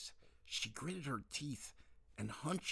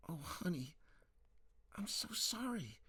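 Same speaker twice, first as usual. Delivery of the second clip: high, pleading mother voice; 6 semitones higher, 3 dB quieter than usual. Acted. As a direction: beg her gently, like a mom.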